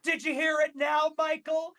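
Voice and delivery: needling mimicry of a sarcastic question; retold sarcasm. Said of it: "said something so sarcastic to him".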